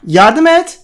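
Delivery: high, loud urgent calls; urgent shouting. Real, not acted. Urging help during fight.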